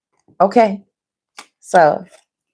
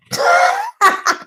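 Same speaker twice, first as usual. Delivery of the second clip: a burst of high, delighted laughter; high squealing laugh. Real, not acted. Reader laughs at the card.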